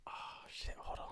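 quick hushed whisper. Real, not acted. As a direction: whisper it aside. Whispered aside during call.

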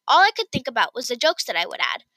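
plain reading voice

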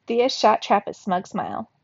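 plain reading voice

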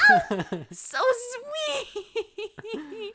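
high, loud, drawn-out aww with laughter; gushing squeal. Real, not acted. Host reacting to a cute mother-daughter scene, gushing. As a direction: squeal and dissolve into giggles.

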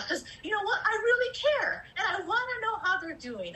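pushing through tears to say it matters; tearful. Real, not acted.

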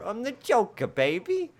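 very high, loud cartoonish Joker; hammed-up squeaky Joker shout; Heath Ledger Joker. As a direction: belt it out as a bad confident Joker. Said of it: "Heath Ledger Joker".

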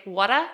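"wada" said with a broad ah and no r at the end; "wada" with an open ah and a dropped r; "wada" copying the Australian way; Australian pronunciation. Retold or imitated. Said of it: "trying to get Arlie to say".

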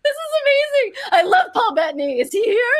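very high, breathless excitement; high giddy gushing; overjoyed squeal. Retold or imitated. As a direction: gush like a starstruck fan. Overjoyed at booking.

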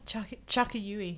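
Chuckayui said slowly, syllable by syllable; slow repeat. Shown as a demonstration. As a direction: spell out "Chuckayui" slowly for a listener. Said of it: "Sorry, what?".

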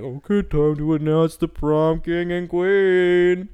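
host calling out the prom king and queen, grand and drawn out; mock announcer. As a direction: announce it grandly to the whole gym. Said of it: "they were like".

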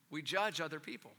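normal talking voice

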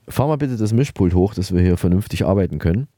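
gruff boss. Acted. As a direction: bark it at the tech like a grumpy boss.

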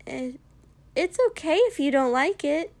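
high, loud, pushed cheer; forcefully cheerful. A narrator's reading. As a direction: insist brightly that it's fine. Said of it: "forcefully cheerful".

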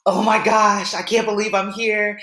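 a touch of high, disbelieving panic; retold freak-out. Swimmer still terrified in the water, retold freak-out. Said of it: "I'm still freaking out".

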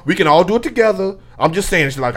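ordinary talk

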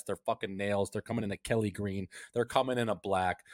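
ordinary talk

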